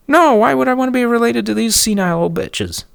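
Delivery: high, loud screeching woman; high furious shriek of a rude neighbor; shrill yelling neighbor. Retold or imitated. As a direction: screech at him like an angry neighbor. Neighbor woman insulting old ladies.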